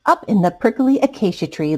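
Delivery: usual speaking voice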